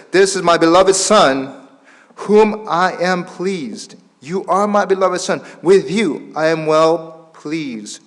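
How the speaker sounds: loud, slow, proclaiming preacher; forceful scripture reading. Acted. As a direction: read the verse out slowly to the congregation. Preacher reads scripture aloud forcefully. (힘 있게 선포하는 성경 봉독)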